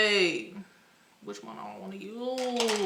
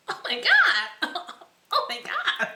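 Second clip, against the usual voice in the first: giddy shock; 14 semitones higher, 5 dB louder than usual. Real, not acted. Overwhelmed by shine.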